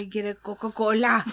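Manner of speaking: lightly Welsh "Let me get a Coca-Cola"; a jokey hint of Welsh ordering a drink; Welsh accent. Shown as a demonstration. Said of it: "It's really Welsh".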